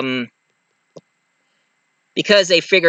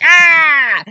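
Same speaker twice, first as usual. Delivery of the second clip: sudden high yelp of pain, much higher and louder; pained cry; 13 semitones higher, 6 dB louder than usual. Retold or imitated. Villain hit by the thrown heel, a high loud yelp. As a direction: yelp like a heel just hit your forehead.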